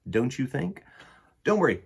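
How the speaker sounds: ordinary delivery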